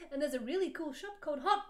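rushed then a loud high squeal on Topic; happy. Acted. Heroine gushing about the shop to Satan, squealing. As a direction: build to a squeal of delight. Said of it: "happy again".

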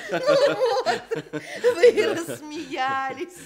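high, loud delighted laugh into the words. Acted. Old lady glad her joke made the guests laugh, laughing. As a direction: laugh it out, pleased the joke worked.

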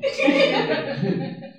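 breathy high laugh; amused airy laugh. Real, not acted. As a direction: burst out laughing. Speaker laughs at own story.

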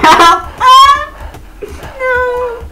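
very high, loud panicked scream. Real, not acted. Freezing in the game, screaming refusal.

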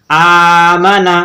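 slow, stretched start to আমানা; drawn-out opening vowel. Shown as a demonstration. Teacher demonstrates the hamza, first vowel of আমানা held long. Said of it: "Hamza টেনে পড়ছি".

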